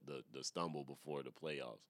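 ordinary talk, nothing marked

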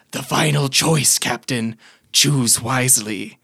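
slow, measured pronouncement; solemn, unhurried skull voice; ominous skull. Acted. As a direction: pose the fateful choice slowly. Skull poses the final choice.

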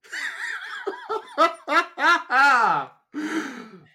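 loud high burst of laughter; big laugh. Real, not acted. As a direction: crack up hard at your own joke. Laughing at own bit.

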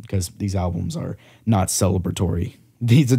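usual speaking voice

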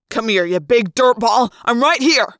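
bold, loud shouting; yelled taunt. A narrator's reading. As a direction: yell a taunt to draw the beast over. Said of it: "Jake yelled".

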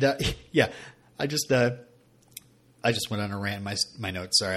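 ordinary talk

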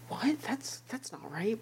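very soft, high retold bafflement; hushed disbelief. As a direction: replay your confusion in a small, high voice. Retelling baffled reaction to scene.

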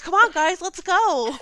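high, loud cheerleading call; rally the stragglers with bouncy energy; peppy aerobics instructor. Acted. Instructor calling down to winded friends from the stair top, peppy.

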